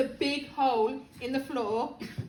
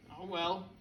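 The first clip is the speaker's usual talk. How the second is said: resigned thought; 9 semitones lower, 6 dB quieter than usual. A narrator's reading. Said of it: "he thought".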